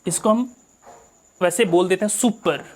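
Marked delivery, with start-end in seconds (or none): none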